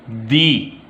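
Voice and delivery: दी said as a clear "dee"; teacher's model "dee". Shown as a demonstration. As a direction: pronounce दी as "dee" before vowels. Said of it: "तो pronunciation होगी".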